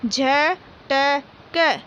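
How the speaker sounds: झटक stretched very slowly, each syllable drawn out; slow syllable reading. Shown as a demonstration. Teacher sounding out झटक for young pupils, very slowly. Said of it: "बोल बोल कर पढ़ेंगे".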